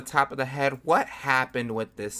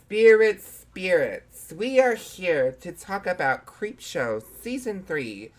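spooky medium voice; 4 semitones higher, slower than usual. Pretend. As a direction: summon the spirits dramatically. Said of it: "mock seance invocation again".

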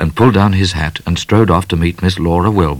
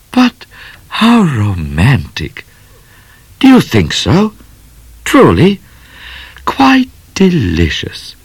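gushing lady; 5 semitones higher than usual. Acted. Miss Laura swooning.

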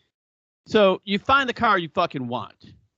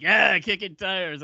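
salesman echo; 3 semitones higher, slower than usual. Retold or imitated. Salesman echoing the brother.

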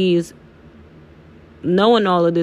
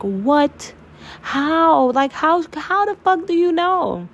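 high-pitched incredulous questions; astonished, pitch climbing very high; retold disbelief; 11 semitones higher, slower than usual. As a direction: re-enact being stunned, voice shooting up. Retold shocked reaction to the reading.

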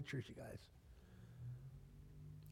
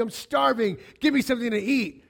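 hungry plea; 8 semitones higher, 8 dB louder than usual. Retold or imitated. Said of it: "your spouse said to you".

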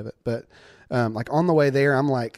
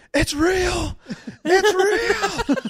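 high, frantic shouting; retold scream; much slower than usual. Boy with a broken nose screaming down the phone at his sister. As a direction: yell it at the top of your voice in panic. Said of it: "I start screaming".

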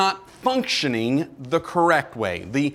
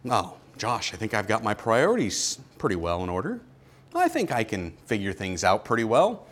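imagined listener; 6 semitones lower, 6 dB quieter than usual. Pretend. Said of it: "imagined listener objects to preacher".

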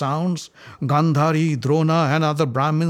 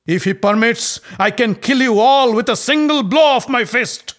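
much higher and louder furious threat; yelled; 10 semitones higher, 8 dB louder than usual. Acted. Bhima threatening Duryodhana in open court, furious yell. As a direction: bellow the threat with full rage. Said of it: "yelled at Duryodhana".

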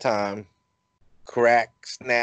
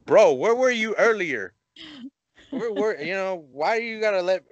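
high, incredulous complaint at a friend; mock outrage, pitched up; exasperated; 10 semitones higher than usual. Man scolding a suddenly chatty friend, high and incredulous. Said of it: "And I was like".

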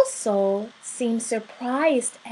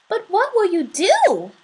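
higher-pitched, mildly nervous question; a little nervous; 9 semitones higher, 5 dB louder than usual. A narrator's reading. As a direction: ask with a touch of worry. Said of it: "just a little nervously".

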